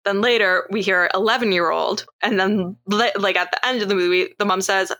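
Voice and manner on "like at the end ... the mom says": ordinary talk, nothing marked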